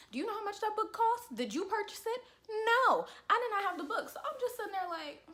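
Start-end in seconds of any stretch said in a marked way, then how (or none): 0.1-4.1 high, loud, sassy challenge; mock indignation. Student challenging professor over a costly book, mock indignation. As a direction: fire back questions and answer them with an emphatic high no.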